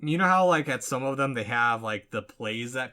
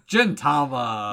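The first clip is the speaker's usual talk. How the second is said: slow, louder, drawn-out title reveal; dramatic title announcement; 5 dB louder, slower than usual. Acted.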